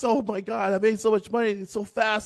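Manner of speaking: high, gushing excitement; high mock glee of delighted customers; excited chat. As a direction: gush like a thrilled viewer. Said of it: "All the people in the chat box".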